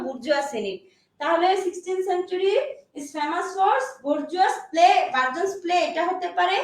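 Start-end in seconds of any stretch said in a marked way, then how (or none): 3.0-5.0 slow dictation. Shown as a demonstration. Teacher dictating to class.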